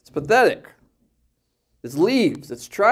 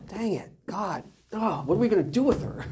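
high, softer put-on griping; mock exasperation; 7 semitones higher, 4 dB quieter than usual. Adam gripes to God about his wife, mock exasperated. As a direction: complain upward, higher and quieter. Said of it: "He blames God".

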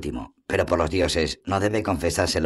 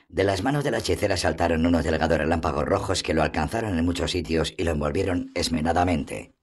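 ordinary delivery

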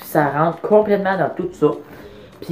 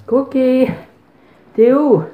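calling out; 7 semitones higher, slower than usual. Real, not acted. Calling her dog outside.

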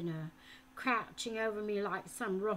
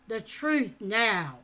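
loud, slow and cold; slow menacing order; 9 dB louder, slower than usual. A narrator's reading. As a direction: demand it with the blade at his chin. Falconer with dagger.

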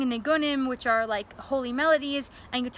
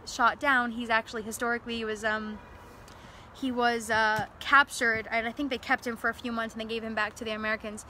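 ordinary delivery

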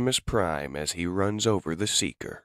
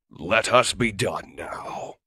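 final verdict; 4 semitones higher, 6 dB louder, slower than usual. Acted. Finishing off the beaten foe.

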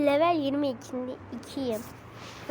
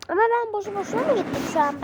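exasperated whine; 6 semitones higher, 5 dB louder, faster than usual. Real, not acted. Frustrated at losing progress.

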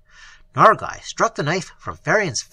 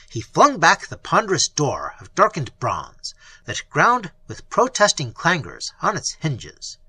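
usual speaking voice

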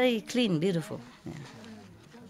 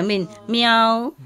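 imitated meow. Shown as a demonstration. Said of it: "It go like this".

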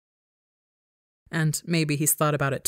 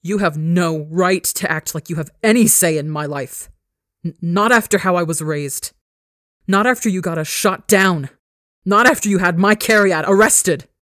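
furious yelling; 6 dB louder than usual. Acted. Son yelling his grievances at father, building fury. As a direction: yell building louder with each accusation. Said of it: "It's incredible to yell at him".

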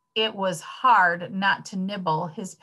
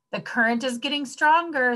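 higher, lilting teacher call; singsong; 6 semitones higher, faster than usual. A narrator's reading. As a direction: lilt it to the dancing class. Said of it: "sang the teacher".

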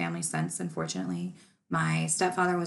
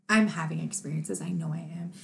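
usual speaking voice

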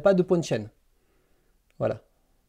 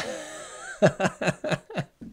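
real, unvoiced cackling laugh; 3 semitones higher, 4 dB quieter than usual. Real, not acted. Laughs at remark about accent.

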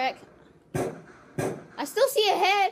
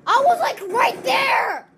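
indignant shout; 6 semitones higher, 4 dB louder, slower than usual. Real, not acted. Complaining after dying.

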